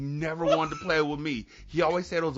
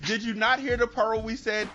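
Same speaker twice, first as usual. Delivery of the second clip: ordinary talk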